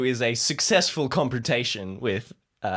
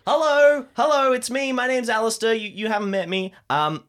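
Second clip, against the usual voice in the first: Alistair's eager voice; 7 semitones higher than usual. Acted. Alistair strides up to the robed leaders, high and eager. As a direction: bluster in like a cheery busybody. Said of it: "He's like".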